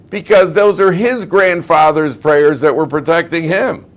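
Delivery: high, loud explanatory retort; emphatic retort. Acted. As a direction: call the answer down into a pit. Fox calls down his retort to lion.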